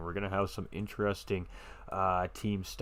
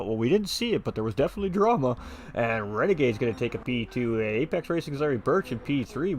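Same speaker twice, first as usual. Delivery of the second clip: excited play-by-play; 4 semitones higher, 4 dB louder than usual. Acted. Live race commentary.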